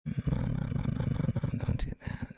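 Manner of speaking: hushed, urgent no no no to himself; whispered self-scolding. Real, not acted. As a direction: scold yourself under your breath. Trader scolding himself under breath.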